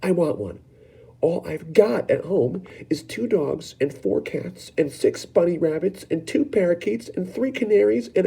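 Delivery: high, rising whiny brat list; spoilt Veruca whining. Acted. Veruca listing her pets to her mother, high and whining.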